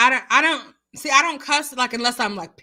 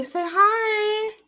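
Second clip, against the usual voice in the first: high pet voice; 10 semitones higher than usual. Real, not acted. Prompting dog to greet.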